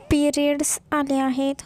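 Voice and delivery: ordinary delivery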